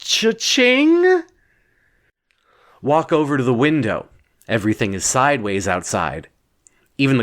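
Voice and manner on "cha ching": high, gleeful cash-register cheer; cha-ching. Retold or imitated. Stepping out of the elevator into the lobby, high gleeful cheer. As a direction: sing it out like you just hit the jackpot. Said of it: "so you get out like".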